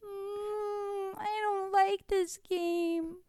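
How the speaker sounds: put-on slow, whimpering child; crying. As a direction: cry it like an upset kid. Kid upset by the quarrel.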